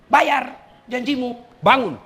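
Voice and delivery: high, loud, slow call to wake; commanding dream voice, slow and loud; booming command. Acted.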